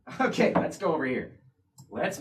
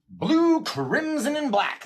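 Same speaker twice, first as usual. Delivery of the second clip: sing-song; 13 semitones higher, much slower than usual. Real, not acted. Repeats the answer, sing-song.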